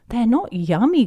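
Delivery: lightly voiced insistent child; child correcting. Peppa voiced, correcting Grandpa.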